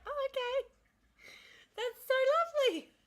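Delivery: very high, loud mock gush. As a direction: squeal it sweetly, imitating a delighted reply. Acted gushing reply in retold call.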